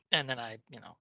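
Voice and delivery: ordinary talk, nothing marked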